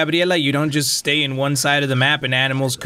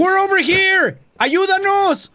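calling out; 14 semitones higher than usual. Real, not acted. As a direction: shout to be heard. Calling out for help.

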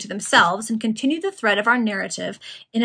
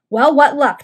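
loud, higher, eager question; hasty demand; 5 semitones higher, 10 dB louder than usual. A narrator's reading. Resurrection Man questioning his accomplice at the door, hasty demand. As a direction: demand news straight away. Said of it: "demanded the Resurrection Man hastily".

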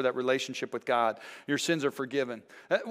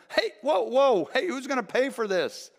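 much higher, alarmed yelp of a homeowner; shocked homeowner crying out about the hole in his roof; startled homeowner; 11 semitones higher, 4 dB louder than usual. Acted. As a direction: squeal like the man whose roof just got cut. Homeowner sees his roof cut.

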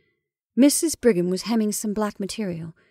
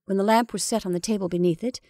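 ordinary delivery

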